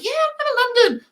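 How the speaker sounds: very high sing-song reply; high woman voice. Retold or imitated. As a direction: squeak it out like the lady. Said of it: "she's like".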